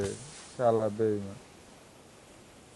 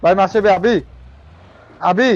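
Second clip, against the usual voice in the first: louder, higher urgent call; urgent shout; 5 semitones higher, 5 dB louder than usual. Real, not acted. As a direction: call out sharply to your buddy. Urgent callout mid fight.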